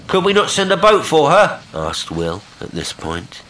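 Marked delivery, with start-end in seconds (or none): 0.1-1.6 high, loud hailed question; raised voice pitched to carry; calling out. A narrator's reading. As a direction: call the question across the water. Will calls to the unseen stranger.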